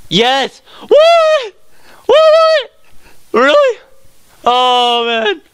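very high, squealed excitement; ecstatic disbelief. Real, not acted. Player finding a lucky surprise outside, ecstatic disbelief. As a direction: shriek in delighted disbelief, easing at the end. Spots something amazing outside the cave.

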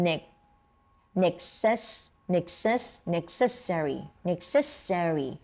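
"necessary" built up piece by piece, slowly; syllable-by-syllable demo. Shown as a demonstration. Teacher has students practise a four-syllable word, syllable-by-syllable demo. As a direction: break "necessary" into four clear syllables, ne-ces-sa-ry. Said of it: "มีสี่พยางค์นะ".

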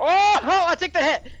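high, loud panicked cry; panicked yell. Real, not acted.

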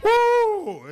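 a high, loud whoop; loud cheering whoop at the crowd; excited woo. Real, not acted. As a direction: let out a big cheer over the laughter.

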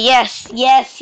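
normal talking voice

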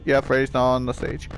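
usual speaking voice

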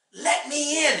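high, loud, mad demand; cross mother shouting to get in; angry demand. A narrator's reading. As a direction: demand entry at the door. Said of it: "'cause she was mad".

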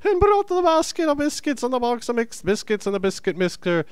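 very high, sing-song fake Irish; badly faked high-pitched Irish recitation; bad Irish accent. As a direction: recite the tongue twister in a squeaky mock-Irish lilt. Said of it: "Your Irish accent is completely a misrepresentation".

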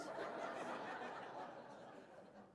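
breathless high laugh, almost silent; airy laugh. Real, not acted. Preacher laughing about where guys go. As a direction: laugh at your own punchline.

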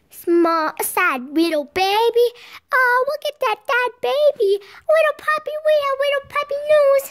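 put-on high, loud cooing baby talk. Girl gushing at her puppy in high loud baby talk. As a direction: gush over the sad little puppy.

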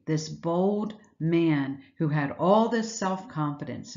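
slow, deliberate build to "self-confidence"; preacher stressing the key word; emphatic slow phrase. Shown as a demonstration. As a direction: weigh each word, land on "self-confidence". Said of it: "the word is self-confidence".

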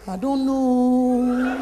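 put-on slow shy girlish reply; mock shy girl answering a boy; coy drawl. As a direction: stretch it out bashfully.

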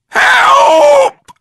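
extremely high, loud shriek; scream. A narrator's reading. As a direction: shriek for help. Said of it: "screamed Missus Twit".

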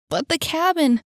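high-pitched urgent exclamation; exclaimed. A narrator's reading. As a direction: cry it out, pitched well up. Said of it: "exclaimed Annie".

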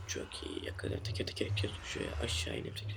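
soft whispered game commentary. Real, not acted. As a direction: murmur to yourself in a whisper. Whispers while playing.

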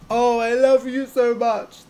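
high, loud gushing love; soppy gushing. Shown as a demonstration. Demo for viewers of a soppy gush. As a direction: gush it out over the top. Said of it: "That's soppy".

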